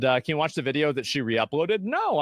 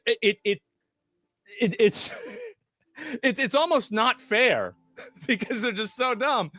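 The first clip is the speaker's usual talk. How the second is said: laughing; 8 semitones higher than usual. Real, not acted. Laughs at opponents' stupidity.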